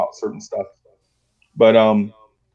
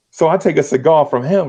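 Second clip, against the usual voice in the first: normal talking voice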